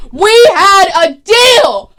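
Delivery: very loud, high, slow shout of betrayal; furious yell. Retold or imitated. Moira storming into Malcolm's office yelling. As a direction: scream it at him, pissed off. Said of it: "pissed the fuck off".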